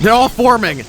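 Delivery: high-pitched, excited call; excited outcry. Pretend. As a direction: pitch it way up, thrilled. Excited as enemies gather.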